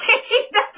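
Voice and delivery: high, sudden laugh; burst of laughter. Real, not acted. Laughing at dramatic scene.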